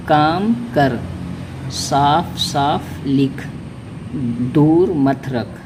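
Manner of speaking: short phrases spoken very slowly and deliberately; slow reading drill. Shown as a demonstration. Teacher reading practice sentences aloud, slow and careful. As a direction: spell each phrase out slowly for learners. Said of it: "उसके बाद है".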